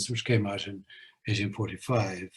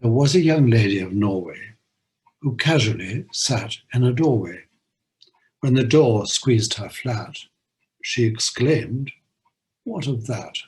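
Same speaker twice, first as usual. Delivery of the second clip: lively poem recitation with a bouncy beat; limerick reading; 4 dB louder than usual. Acted. Host reading the Norway limerick aloud, lively recitation. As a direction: deliver the nonsense rhyme with relish.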